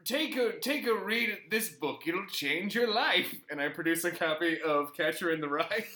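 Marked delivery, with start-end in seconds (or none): 0.0-3.3 high puppet voice offering a book; a hint of the frail posh puppet; lightly voiced posh mole. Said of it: "puppet voice continues".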